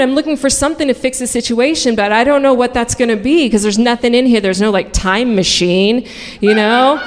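ordinary talk, nothing marked

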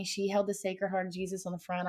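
plain reading voice

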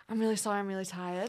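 soft, fading mock tired excuse. Boyfriend turning her down in bed, softly tired. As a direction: sigh it out like a sleepy boyfriend. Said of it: "he literally goes".

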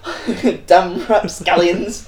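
slow, loud mock cursing through a laugh; mock indignation. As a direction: draw out the playful curse while laughing. Mock outrage at being hoodwinked.

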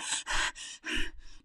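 mimicked out-of-breath puff; imitated heavy breathing. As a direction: puff out air as if winded. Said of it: "I'm there like".

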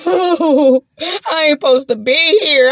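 whimpering, crying out in fear; retold panic, crying voice; mock panicked cry. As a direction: act out the terrified whine, half sobbing. Retelling her panic in the car.

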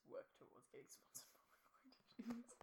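hushed pleading whisper. Real, not acted. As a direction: whisper it like a secret. Whispered plea to sponsor.

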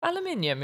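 Aluminium said as al-uh-MIN-ee-um; high, quick model of Aluminium; British pronunciation. Shown as a demonstration. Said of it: "so you say that one correctly".

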